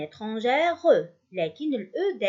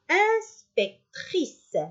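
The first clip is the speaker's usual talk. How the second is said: "Inspectrice" slowly as ins, pec, trice; slow, separated syllables in "Inspectrice"; 9 semitones higher, much slower than usual. Shown as a demonstration. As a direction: sound out "Inspectrice" for pupils, starting high. Teacher sounding out the new form.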